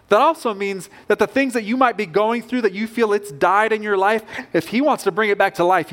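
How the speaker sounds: usual speaking voice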